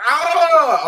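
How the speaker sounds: high, loud ecstatic yelp; excited shout. Retold or imitated. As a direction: let out a burst of pure joy. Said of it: "I was on a high".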